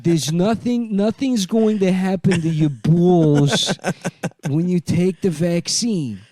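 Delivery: high, loud, slow put-on voice; hammed-up public service voice; mock announcer.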